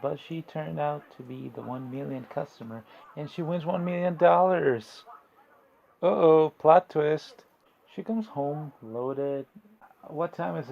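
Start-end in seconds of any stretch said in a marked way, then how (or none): 3.2-5.0 loud, high game-show style reveal; excited announcement. Retold or imitated. Announcing the big prize win. 6.0-7.4 very loud, slow twist reveal; drawn-out, loud "uh-oh" of doom; mock-dramatic announcement.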